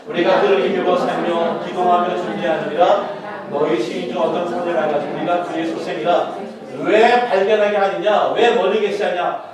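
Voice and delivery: slow, loud, declaiming the verse; loud slow sermon reading; scripture reading. Acted. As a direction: lead the reading with weight. Congregational reading.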